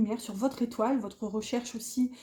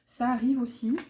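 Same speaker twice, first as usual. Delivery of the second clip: normal talking voice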